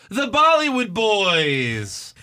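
slow, high, drawn-out introduction; ring announcer. Pretend. Podcaster introducing the opening match, mock announcer style. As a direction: stretch the team name out like an announcer.

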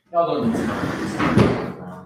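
slow drawn-out laughing repeat. Real, not acted.

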